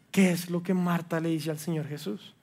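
normal talking voice

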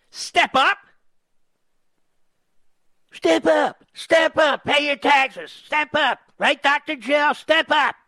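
high, sneering mimicry; mocking repeat. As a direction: throw his words back at him. Mocking the caller's words.

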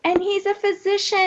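high, sugary churchy voice, fast; mock sweet women's-event voice; Minnie Mouse voice. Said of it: "I call it the Minnie Mouse voice".

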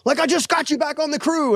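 high, exasperated angry father; mad dad. Retold or imitated. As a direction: pitch way up as a fed-up dad. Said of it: "He's mad".

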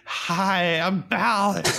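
mock fake voice. Host teasing co-host's fake introduction, mock voice. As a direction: tease with a put-on hello. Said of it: "do a fake voice".

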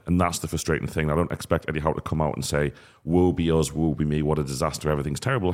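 normal talking voice

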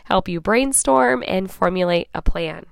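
ordinary talk, nothing marked

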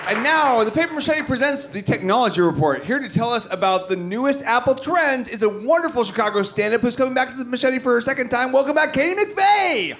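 loud, fast emcee introduction; announcer. Acted. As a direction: hype up the next act.

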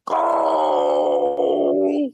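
long, low imitated "oh"; Big Show. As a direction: imitate a big man's drawn-out low reaction. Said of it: "And Big Show's like".

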